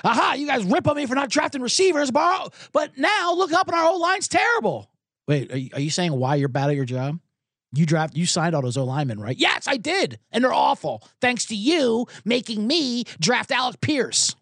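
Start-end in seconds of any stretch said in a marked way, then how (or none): 0.0-4.9 mocking GM whine. GM snapping back at the media in a high whine. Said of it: "he's like". 9.4-14.3 mock GM voice. GM retold in mock voice.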